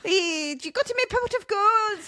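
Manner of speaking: high, exaggerated leprechaun-style Irish; hammed-up Irish accent. Host playing leprechaun about gold, hammed-up Irish accent.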